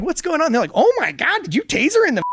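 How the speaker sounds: high, loud mock paramedic voice; shocked paramedics. Paramedics arriving and asking officer, high shocked mock voice. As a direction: gasp it out in comic shock.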